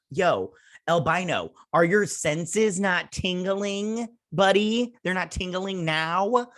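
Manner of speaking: high, drawn-out teasing jab; mock taunting. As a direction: needle him like a smug buddy. Speaker mocks character directly.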